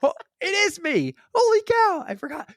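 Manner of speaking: high, laughing disbelief; amazed laughing realization; surprised laughing. Retold or imitated. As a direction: caught out again and cracking up. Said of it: "And I'm like".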